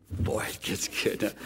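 a hushed, secretive tease; teasing whisper. Acted. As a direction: whisper it to hook them on the story. Teasing the congregation with suspense.

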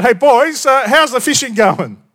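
high, loud friendly call across the water; yelling out. Retold or imitated. Jesus calling from the beach to the fishermen, loud cheerful yell. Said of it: "and he yells out".

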